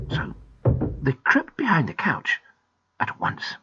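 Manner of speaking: plain reading voice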